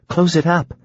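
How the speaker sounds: wife's line, pitched high. A narrator's reading. Narrator voices the wife's order.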